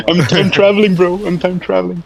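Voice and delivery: giddy, laughing through the words. Real, not acted. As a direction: chuckle it out, amused. Laughing at own glitching audio.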